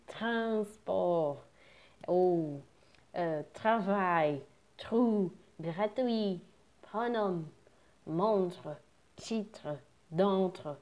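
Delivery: "transport", "travail", "montre" said with the R deep in the throat; throaty French R. Shown as a demonstration. Teacher drills the throat R in French words. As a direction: hawk the R from the throat, as the lesson says. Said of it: "como se tivesse um catarro na garganta".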